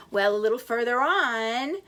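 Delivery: ordinary delivery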